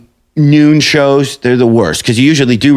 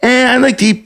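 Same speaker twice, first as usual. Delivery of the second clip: higher, bright robotic host chirp; chirpy fembot host; 10 semitones higher than usual. Retold or imitated. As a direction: sound like a smiling newsreader not listening. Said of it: "those women on there are like fembots".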